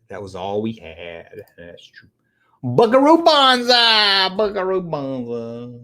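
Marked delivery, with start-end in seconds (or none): 2.6-4.3 high, drawn-out name, savoured; relishing the name. Pretend. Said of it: "I just like saying the name". 4.4-5.8 savouring the name. Pretend. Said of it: "I just like saying the name".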